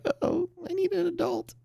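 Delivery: put-on squeaky high panicked kid voice; high cartoon voice for a worried bear; scared little bear. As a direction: squeak it like a frightened child. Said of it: "this bear is like".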